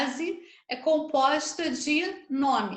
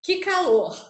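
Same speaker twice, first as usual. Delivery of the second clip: mock complaint; 4 semitones higher, 5 dB louder than usual. Shown as a demonstration. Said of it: "se eu chego e digo".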